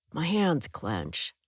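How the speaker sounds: plain reading voice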